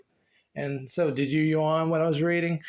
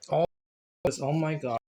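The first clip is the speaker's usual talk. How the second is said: ordinary talk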